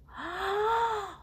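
deep breath in. Retold or imitated. Teacher showing her kids' candle breath, sharp inhale. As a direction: suck in air for the candles. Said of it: "Blow out five birthday candles".

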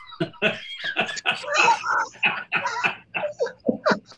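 long high burst of laughter; a real peal of laughter; big laugh. Real, not acted. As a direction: laugh out loud, hard and high. Laughing at aunt's scolding.

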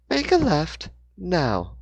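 sudden high chime of a GPS; computer voice. A narrator's reading. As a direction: pop in like a navigation prompt. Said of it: "The computer chimed in suddenly".

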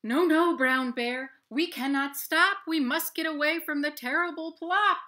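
high, very loud frantic chorus; panicked animals. Acted. Fleeing animals shouting at the bear, high and frantic. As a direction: shout it in a terrified rush.

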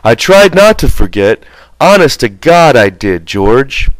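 put-on earnest, childlike plea; pleading Lenny. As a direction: beg George to believe him.

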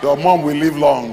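loud, slow proclamation; booming, drawn-out blessing; full-force declaration. Real, not acted. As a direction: declare it slowly over the congregation.

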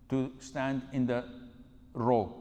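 normal talking voice

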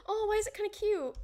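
high, excited gasp of surprise; delighted gasp. Real, not acted. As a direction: gush over something cute. Sees a cute mob.

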